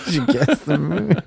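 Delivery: giggling through the words; laughing. Real, not acted. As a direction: laugh it out while teasing. Teasing guest, laughing.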